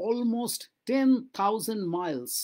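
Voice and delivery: each word drawn out slowly for learners; slow model reading. Shown as a demonstration. Teacher to Bengali students, slow careful reading. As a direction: read it slowly so students can follow. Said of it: "English teacher modelling slow reading".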